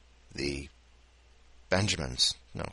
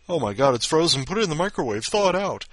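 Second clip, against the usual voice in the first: mock panic; 6 semitones higher, 6 dB louder than usual. Joking panic over frozen photo.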